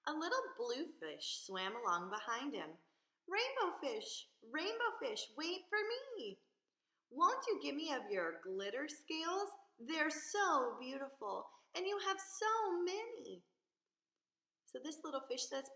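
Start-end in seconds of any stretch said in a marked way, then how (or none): 3.3-6.4 high, calling after him; high little fish pleading to wait. Acted. 7.1-13.4 high, wistful asking voice; pleading little fish. Acted.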